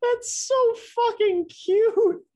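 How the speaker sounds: very high, slow squeal of adoration; gushing. Real, not acted. Co-host melting over the sister's song, gushing. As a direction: coo it way up high, drawn out.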